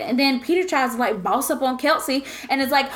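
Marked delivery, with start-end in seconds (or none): none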